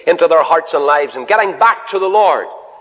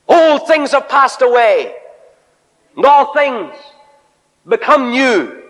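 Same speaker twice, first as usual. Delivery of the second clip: full-force preaching; 10 semitones higher than usual. Acted. Preacher proclaiming the new life to the congregation, full force. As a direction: ring it out to the back row.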